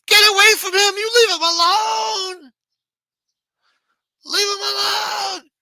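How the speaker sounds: put-on high, shrill-pitched old lady yelling at full volume; grandmother screaming. Grandmother yelling at the cop to leave her grandson alone. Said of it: "here comes my grandmother".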